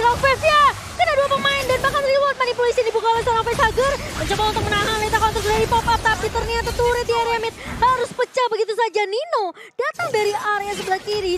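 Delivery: loud, high hype call; excited play-by-play. Real, not acted. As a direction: shout the play as it unfolds. Teamfight play-by-play.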